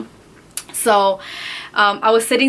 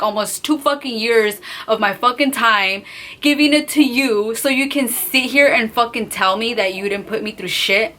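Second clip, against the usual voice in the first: ordinary talk, nothing marked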